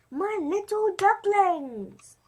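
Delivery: high, loud and slow, drawn out with delight; proud mother duck. Acted. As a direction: coo over the new babies proudly. Mother duck greets new ducklings.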